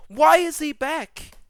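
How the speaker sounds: slow, high, loud exasperated question; loud disbelief. Real, not acted. Asking viewers why Palpatine returned, in loud outrage.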